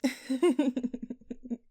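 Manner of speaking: loud, amused laugh brushing it off; a bright scoffing laugh; dismissive laugh. Acted. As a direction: laugh the accusation away. Villain laughs off accusation.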